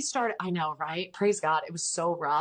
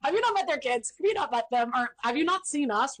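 incredulous retort; 6 semitones higher than usual. Retold or imitated. Retelling her indignant reply.

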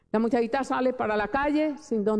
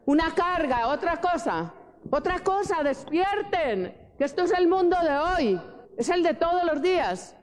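high-pitched preaching, urgent and insistent; rousing exhortation; 5 semitones higher than usual. Real, not acted. Preacher telling listeners to wake to today's world, rousing exhortation. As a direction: shake a drowsy congregation awake.

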